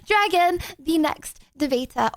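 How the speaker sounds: high, whiny pleading host voice; mock crying plea. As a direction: beg to bring in the next one.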